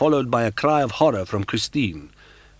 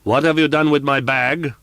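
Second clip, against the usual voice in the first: higher and louder, grating; grating metallic voice; 8 semitones higher, 9 dB louder than usual. Acted. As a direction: spit it out like metal. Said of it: "grated out these metallic syllables".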